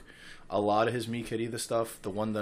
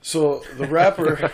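laughing, slowing; 6 semitones higher, 11 dB louder, slower than usual. Real, not acted. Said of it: "I'm not even gonna do an accent".

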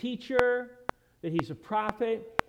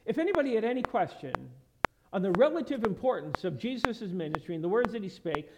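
ordinary talk

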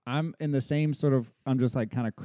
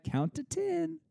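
imitated toddler; 3 dB quieter, slower than usual. Toddler telling dad to count.

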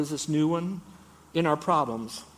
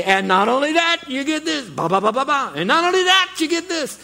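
much higher and louder hammed-up announcer; game show host; 12 semitones higher, 9 dB louder than usual. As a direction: hype each extra prize like a TV host. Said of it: "And then they say".

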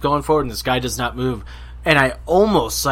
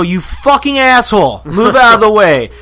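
loud, high-pitched angry yell; angry confrontation; 8 semitones higher, 8 dB louder than usual. Retold or imitated. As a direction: yell the retold insult at full tilt. Acted sidewalk quarrel.

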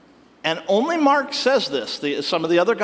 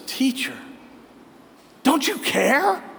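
pleading disciple; 4 semitones higher than usual. Retold or imitated. Peter waking Jesus in the storm, hurt and pleading. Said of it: "wakes Jesus up and says".

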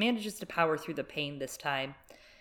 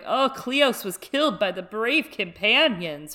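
higher, louder showy proclamation; mock loud announcement; 5 semitones higher, 6 dB louder than usual. Jaime announcing Cleos's death to the Freys, loud and showy. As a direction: say it so the whole hall hears, hammed up. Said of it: "for everyone to hear".

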